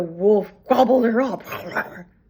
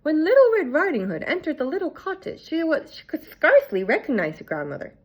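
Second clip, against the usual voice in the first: ordinary delivery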